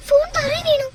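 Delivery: high, startled yelp of alarm; alarmed cry. Acted. Wife cries out as her phone falls during the struggle. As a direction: cry out in panic.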